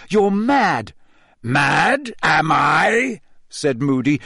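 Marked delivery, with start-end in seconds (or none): 0.1-0.9 high, loud accusation; uncontrolled outburst. A narrator's reading. Harry cannot stop himself accusing Moody, outburst. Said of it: "He couldn't stop himself". 1.4-3.2 rising, indignant. A narrator's reading. Moody retorts to Harry's accusation, voice rising. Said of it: "his voice rising uncontrollably".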